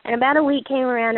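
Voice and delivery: ordinary talk, nothing marked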